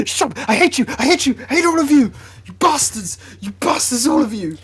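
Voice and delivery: high, loud, hammed-up fury; mock angry outburst. Teased by friends, he yells in mock rage. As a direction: yell "I hate you" with pretend rage.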